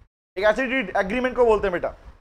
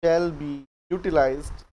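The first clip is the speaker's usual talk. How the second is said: very slow soft dictation; 6 semitones lower, 4 dB quieter, much slower than usual. Shown as a demonstration. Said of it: "next लिखो इसी में".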